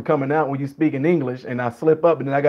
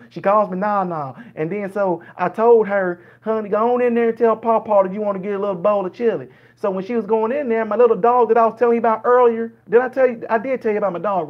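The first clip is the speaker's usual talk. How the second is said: higher-pitched rambling Southern storyteller; slow-to-the-point Southern dialect; 5 semitones higher than usual. Acted. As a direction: ramble sociably in a Southern accent, in no hurry. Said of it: "so they'll go all the way around".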